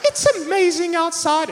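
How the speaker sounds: hammed-up piglet cheer; Peppa Pig. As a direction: chirp it brightly like a piglet. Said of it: "Peppa Pig goes".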